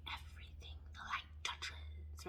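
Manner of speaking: hushed slow whisper; Lion King quote whispered. Retold or imitated. Quoting the Lion King to viewers, soft slow whisper. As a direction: breathe the line like a secret. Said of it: "about the Lion King".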